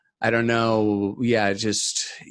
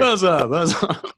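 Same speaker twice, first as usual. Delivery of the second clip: mock coach call; 7 semitones higher than usual. Coach calling again.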